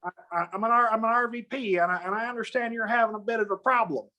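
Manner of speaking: a hint of an older Southern lady's drawl; Ann Richards-like drawl. Regional VP calling, lightly mock Southern drawl. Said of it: "she sounds like the former governor of Texas".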